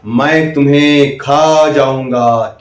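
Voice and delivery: slow menacing lion voice; lion's threat. Shown as a demonstration. Demo for kids, a lion threatening, slow and menacing. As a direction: growl it out slowly like a lion. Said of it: "शेर ने कहा".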